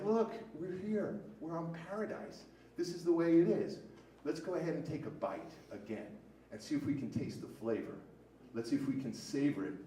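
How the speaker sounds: fading down to almost nothing at the end; calm sermon-like close. Acted. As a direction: lean in and share the secret quietly. Closing message of the talk.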